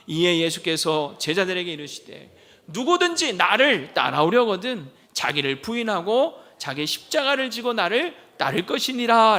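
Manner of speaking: loud, declaimed Bible verse; scripture reading. Acted. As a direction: lead the congregation in reading aloud, forcefully. Leads congregation reading scripture aloud. (힘주어 크게 성경을 봉독)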